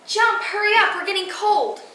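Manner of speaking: high, loud call from the back of the line; impatient kids yelling. Acted. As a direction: yell like a kid hurrying someone along. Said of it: "behind me yelling".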